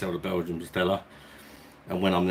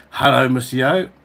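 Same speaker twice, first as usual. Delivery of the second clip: hearty greeting; 3 semitones higher, 6 dB louder, slower than usual. Real, not acted. Greets viewer in mock French.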